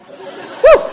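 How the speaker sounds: high, loud laughing woo; excited whoop. Real, not acted. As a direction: let out a thrilled whoop. Excited whoop before grabbing Ron.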